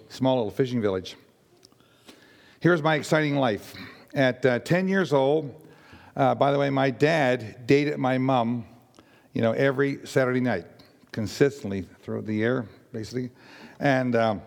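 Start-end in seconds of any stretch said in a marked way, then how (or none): none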